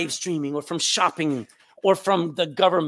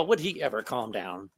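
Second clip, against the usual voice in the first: low, softer aside from an annoyed churchgoer; deep, subdued complaint; disapproving grumble; 11 semitones lower, 7 dB quieter than usual. Acted. Disapproving churchgoer grumbles under breath.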